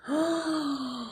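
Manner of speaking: excited gasp. Acted. Excited reaction to story.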